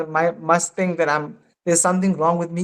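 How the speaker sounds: ordinary delivery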